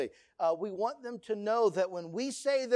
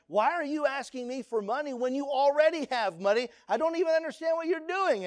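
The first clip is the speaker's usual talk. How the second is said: higher, louder mock scolding of a son; angry dad; 7 semitones higher, 4 dB louder than usual. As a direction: play the dad snapping at his boy, pitched up. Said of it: "got mad all over again".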